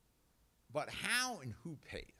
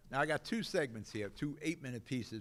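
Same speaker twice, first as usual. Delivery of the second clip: normal talking voice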